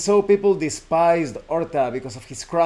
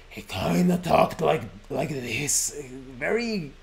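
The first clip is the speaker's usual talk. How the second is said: imitating terrifying narrator. Shown as a demonstration. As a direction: ease into the cassette narrator's eerie tone, trailing off. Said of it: "let's see if I can imitate it".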